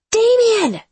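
very high, loud desperate scream. A narrator's reading. Lex calls for Damien, desperate scream. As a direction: scream the name at the house. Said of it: "I scream".

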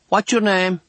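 put-on high-pitched girl calling out; girl's voice. A narrator's reading. Girl calling to unseen patient next door, high girlish voice. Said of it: "a girl's voice says".

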